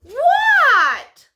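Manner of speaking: high, loud alarmed what; a child's loud startled cry; startled shout. Acted. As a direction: squawk it out in a panic. Froggy startled, shouting back.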